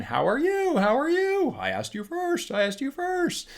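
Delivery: high squeaky mock Grinch shouting into the echo; imitating the Grinch taunting his echo; Grinch echo bit. Grinch yelling into the echo, high mock voice. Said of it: "he's going".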